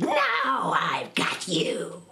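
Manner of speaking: slow, hammed-up witch cry; a gloating witch's slow mock shout.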